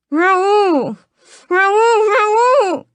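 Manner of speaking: gagged captive calling out desperately; muffled gagged cries. A narrator's reading. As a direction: cry his name into the cloth. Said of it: "the gag muffling all my words".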